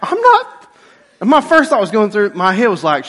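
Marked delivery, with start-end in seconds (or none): 0.0-0.7 exasperated outburst. Retold or imitated. Husband protesting to his wife, high exasperated outburst. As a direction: splutter at your wife in disbelief.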